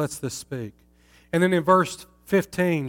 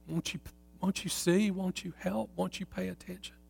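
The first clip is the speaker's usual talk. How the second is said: much softer, higher, pleading call; 4 semitones higher, 9 dB quieter than usual. Acted. The city reaches out to passers-by, soft plea. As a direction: beg softly for someone to notice. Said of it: "She stretches out her hands to the passers-by".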